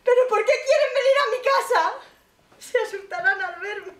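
high, loud crying denial, rising; hysterical insistence. Acted. Old mother shrieking at the officer that she is not upset. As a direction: deny being upset while coming apart.

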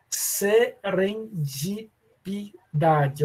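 SERENDIPIDADE very slowly, syllable by syllable; careful slow pronunciation. Real, not acted. Teacher tackling a word they always trip over, SERENDIPIDADE said slowly. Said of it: "Eu preciso falar devagar".

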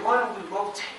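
usual speaking voice

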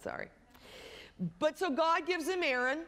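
usual speaking voice

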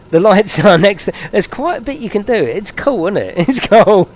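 high, loud enthusiasm; real bubbling excitement; getting all excited. Real, not acted. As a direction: gush excitedly about a new find. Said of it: "Sorry, I'm getting all excited now".

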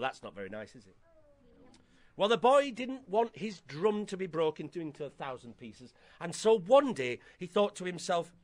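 ordinary delivery, nothing marked

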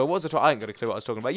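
ordinary delivery

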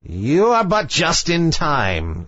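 high, slow witch voice; high-pitched witch, savouring each word; sea witch. A narrator's reading. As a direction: draw it out as a scheming witch.

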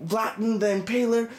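usual speaking voice

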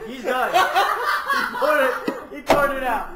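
shouting through laughter; laughing. Real, not acted. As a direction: yell it while laughing. Laughing at a contestant.

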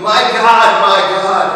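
Jesus crying out from the cross in anguish, screaming; screamed. A narrator's reading. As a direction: scream it out into the darkness, in agony. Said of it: "Jesus screamed into the darkness".

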